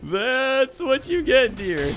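slow, high gloating taunt; drawn-out villainous gloat. Acted. Taunting opponent again.

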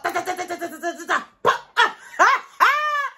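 high rat-a-tat burst; mock gunfire breaking into high laughter; imitated machine-gun fire. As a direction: fire off a high string of shots with your mouth. Imitates a rapid round of gunfire.

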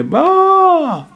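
high, drawn-out mock wail; imitated child's cry. Preacher mimics a child crying from the other room, high mock wail. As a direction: cry out like a burnt child, put on. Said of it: "The child begin to cry".

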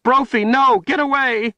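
high, loud desperate scream; frantic shrieking plea. A narrator's reading. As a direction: scream the warning at the wolf. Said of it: "Kalen screamed".

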